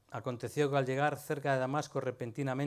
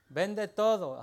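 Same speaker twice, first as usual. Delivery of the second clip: mildly raised, higher voice; emphatic command; 5 semitones higher, 7 dB louder, much slower than usual. Acted. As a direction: deliver it as a firm, louder order. Preacher voices the command to sell.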